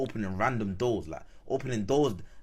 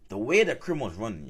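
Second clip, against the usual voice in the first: normal talking voice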